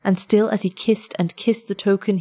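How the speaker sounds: usual speaking voice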